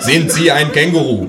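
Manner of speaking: a hint of a pushy policeman; police officer. Police at the door asking to come in, lightly voiced officer.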